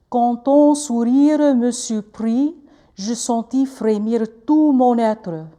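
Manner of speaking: slow, lips working on every French vowel of "Quand ton sourire me surprit"; French with rounded lips. Shown as a demonstration. Teacher showing students French lip work, slow and rounded. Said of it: "I'll do that again".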